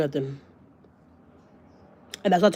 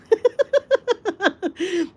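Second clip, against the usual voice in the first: high, long cackle; real high cackle; laughing; 13 semitones higher than usual. Real, not acted. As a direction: burst into high-pitched laughter. Laughs after her retold threat.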